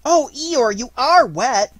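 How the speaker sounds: high, loud squeal of concern; exclaiming. A narrator's reading. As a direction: cry out in high-pitched dismay. Piglet exclaiming at soaked Eeyore.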